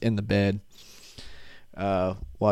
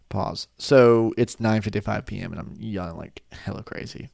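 ordinary talk

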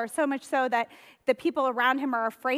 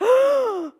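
big high gasp of shock; sharp loud intake of breath; 13 semitones higher, 9 dB louder than usual. Acted. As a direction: gasp loudly as if outraged. Child's ducky story, shocked reaction.

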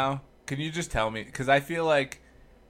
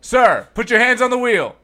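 loud, commanding police order; stern cop; 6 semitones higher, 10 dB louder, slower than usual. Pretend. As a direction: bark it like a traffic cop. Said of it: "oh, you wanna act it out?".

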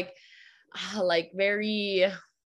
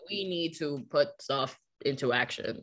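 lower, no-nonsense voice; down-to-business voice; 4 semitones lower, 5 dB quieter than usual. Retold or imitated. Teacher acting out her move-it-along side, lower and businesslike. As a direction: drop low and get serious. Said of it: "Even my voice changed in that".

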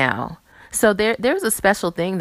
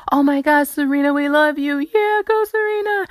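high, gushing fan voice, cheering; adoring crowd; 10 semitones higher, 3 dB louder than usual. Retold or imitated. Vlogger imitating fans cheering Serena, high and gushing. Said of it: "people are like".

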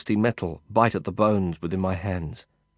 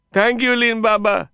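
happy shout; 6 dB louder than usual. A narrator's reading. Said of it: "he shouted back happily".

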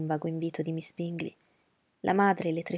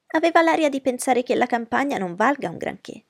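higher, louder fussy mother; fussy society mother, higher voice; Mrs Bennet's voice; 7 semitones higher, 8 dB louder than usual. Acted. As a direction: play the anxious mother.

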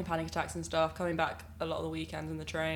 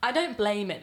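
normal talking voice

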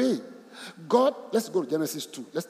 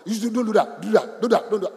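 mock strict command; 4 semitones higher, 7 dB louder than usual. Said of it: "A few always think, "Command".

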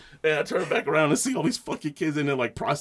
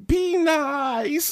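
high, drawn-out reprimand; high-pitched mock scolding; scolding mother; 12 semitones higher than usual. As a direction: imitate a mom calling out a telling-off. Imitating scolding bystander mother.

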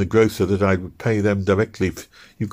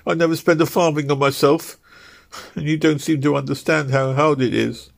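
higher, pleading voice on the edge of tears; tearful wife; 9 semitones higher than usual. Acted. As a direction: defend yourself through tears, voice pitched up. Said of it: "Ruth sobbed".

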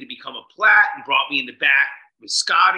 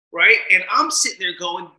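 ordinary talk, nothing marked

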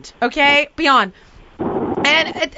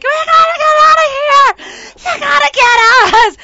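high, loud, frantic demand; retold screaming; 12 semitones higher, 7 dB louder than usual. Woman spinning in the kayak screaming at Heidi, frantic. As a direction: scream for rescue. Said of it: "I'm screaming".

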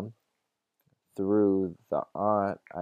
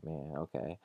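soft, let-down mumble; disappointed; 3 semitones lower, 9 dB quieter than usual. Retold or imitated. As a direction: imitate a mom giving in, deflated and quiet. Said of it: "the mom is all disappointed".

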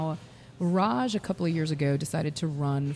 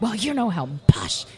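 loud, higher mock French accent; huffy French send-off, louder and pitched up; 4 semitones higher, 7 dB louder than usual. French stranger before riding away on his bike, mock French accent. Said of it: "he has a French accent".

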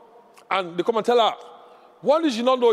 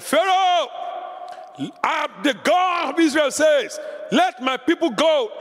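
Moses commanding; 7 semitones higher than usual. Acted. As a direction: stand before the king and demand. Moses confronting Pharaoh.

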